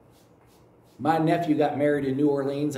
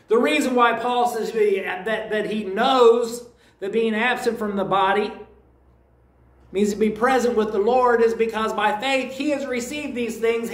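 higher, louder preaching build; fervent preaching; 6 semitones higher, 4 dB louder than usual. Acted. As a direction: preach it with rising conviction. Preaching at full force.

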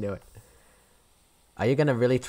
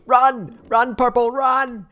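much higher, loud frantic calling out; panicked warning cry; urgent shout; 11 semitones higher, 4 dB louder, slower than usual. Real, not acted. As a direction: yell at Purple to get away. Urging teammate to flee killer.

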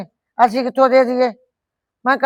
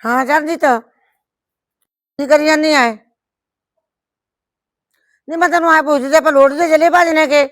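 brighter and louder, calling down the phone line; raised phone voice; 3 semitones higher, 3 dB louder than usual. Acted. Woman on the phone to her friend Charanjit, louder and higher.

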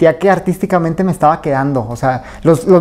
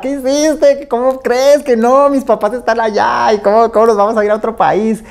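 mild imitated tearful pleading, higher; a touch of a crying wife's protest, higher-pitched; retold crying wife; 5 semitones higher than usual. Wife protesting the move to Mexico, in a mildly imitated tearful voice. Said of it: "No, se puso a llorar".

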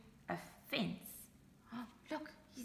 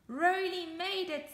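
higher, louder happy cry; relieved joy; 8 semitones higher, 6 dB louder than usual. Acted. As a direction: cheer with relief. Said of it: "said Bob".

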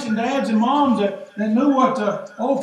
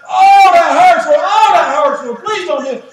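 high, loud wail of pain to the Lord; pained cry; 6 semitones higher, 11 dB louder than usual. Acted. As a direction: cry out as if being spanked and begging. Crying out under God's spanking.

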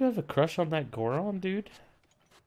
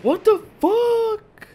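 slow, much higher shout; outraged shout; 13 semitones higher, 6 dB louder, much slower than usual. Real, not acted. Streamer struck by lightning, outraged slow shout. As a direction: drag it out in disbelief.

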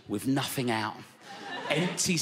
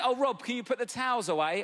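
wife's request; 10 semitones higher than usual. Retold or imitated. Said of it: "She went".

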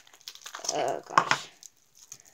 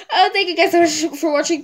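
louder, higher, choked-up thank-you; teary thanks; 5 semitones higher, 11 dB louder than usual. Real, not acted. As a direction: thank them while tearing up. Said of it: "I'm about to cry".

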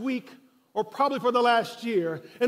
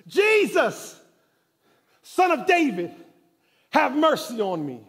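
desperate shout; 4 semitones higher, 6 dB louder than usual. Acted. Blind man crying out to Jesus over the crowd, loud and high. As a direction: shout it out, stretched and pleading. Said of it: "And he says".

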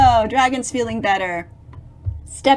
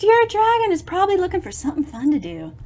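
plain reading voice

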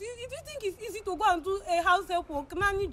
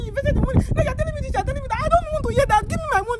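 angry demand; 2 semitones higher, 7 dB louder than usual. Real, not acted. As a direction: yell at her mother for the money. Daughter demanding her money back.

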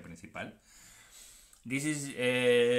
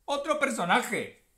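loud, higher, delighted shout; excited cheer; 9 semitones higher, 6 dB louder than usual. Real, not acted. Opening booster packs and hitting a streak, a loud excited cheer. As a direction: burst out thrilled at the lucky pull. Pulls another character card.

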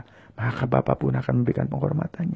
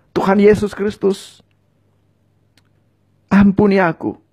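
higher, louder, impassioned pleas for forgiveness; fervent pleading prayer; 10 semitones higher, 6 dB louder than usual. Acted. Preacher voicing sinners' confession for viewers to repeat, fervent and pleading. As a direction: cry out each sin to God with rising urgency.

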